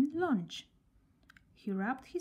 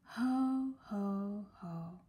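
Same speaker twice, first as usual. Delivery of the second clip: a mournful sinking laugh; much slower than usual. A narrator's reading. Sad girl mournfully trying ho ho. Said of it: "she added mournfully".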